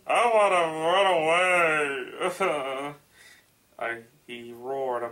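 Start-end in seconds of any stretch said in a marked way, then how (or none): 0.1-2.9 loud, slow, high tearful whine; mock wailing. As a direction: wail it out, hammed up. Mock distress at the story.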